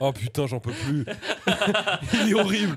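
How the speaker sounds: laughing hard mid-word; cracking up at the host. Real, not acted. Friend fails search, laughing. (fou rire)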